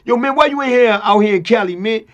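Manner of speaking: high, loud comic imitation of a caller. Friend calling [name] to ask what's up, comic loud imitation. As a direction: demand answers down the phone, high and loud. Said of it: "[name] funny, he come on the phone".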